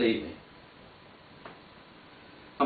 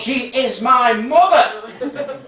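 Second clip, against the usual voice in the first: laughing; 10 semitones higher, 5 dB louder, much slower than usual. Acted. Laughing retort to Steve.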